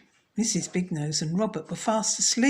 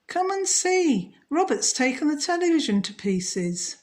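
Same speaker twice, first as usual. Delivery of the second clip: calling out; 9 semitones higher, 4 dB louder than usual. Acted. Wife calling her husband to see the television. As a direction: call him over in dismay. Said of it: "Missus Amazing called her husband".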